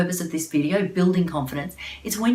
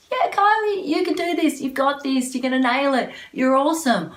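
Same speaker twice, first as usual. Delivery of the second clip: much higher, bouncy cheerleader pep; 9 semitones higher, 4 dB louder than usual. Retold or imitated. Inner cheerleader pumping Kylie up, high excited pep. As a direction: cheer yourself on like a little cheerleader. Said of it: "is it a little cheerleader going".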